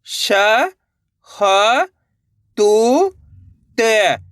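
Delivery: each syllable held long and separate, vowels swooping up, last one dropping. Shown as a demonstration. Teacher sounding out a word for learners, syllable by syllable. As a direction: spell it out sound by sound with pauses. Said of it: "एक अक्षर का उच्चारण करना है".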